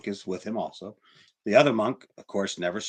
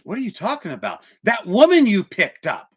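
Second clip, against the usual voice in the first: much higher pitched, rising to a loud accusation; the young monk still fuming about the woman; exasperated younger monk; 10 semitones higher, 6 dB louder than usual. Acted. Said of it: "And he says".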